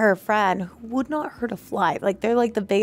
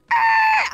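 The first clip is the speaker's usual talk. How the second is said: high-pitched cart skid noise; imitated skid screech. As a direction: squeal like skidding wheels.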